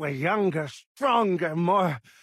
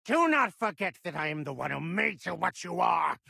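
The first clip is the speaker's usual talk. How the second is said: ordinary talk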